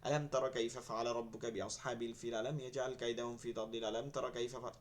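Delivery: brisk, low rote recital; quick memorizing recitation. Shown as a demonstration. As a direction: rattle it off in one run. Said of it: "তখন কি পড়-, পড়ি?".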